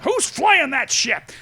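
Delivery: high, loud and drawn-out call; Han calling out. Retold or imitated. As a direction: shout it up at the cockpit, slowly.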